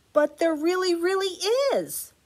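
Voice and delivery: put-on high, pleading little boy; insisting child voice. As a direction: beg them to believe you.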